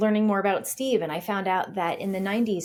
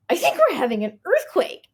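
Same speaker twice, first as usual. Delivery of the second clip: panicked; 10 semitones higher, 5 dB louder than usual. Retold or imitated. Panicked quote of earthquake call.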